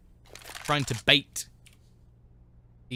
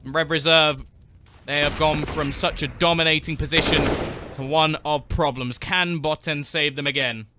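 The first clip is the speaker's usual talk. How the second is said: louder, higher rising commentary; excited play-by-play; 4 semitones higher, 6 dB louder than usual. Acted. Caster calling the comeback win, excited and loud. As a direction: call the round's finish, building hype. Live casting of the kills.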